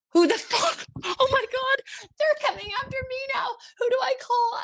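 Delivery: high squeaky mock panic; panicked. Retelling her panic at the calls.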